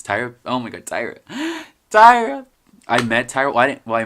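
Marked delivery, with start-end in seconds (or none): 1.3-2.5 high, loud gasped fangirl squeal; starstruck gasp. Real, not acted. Vlogger fangirling over her favourite show's host, excited squeal.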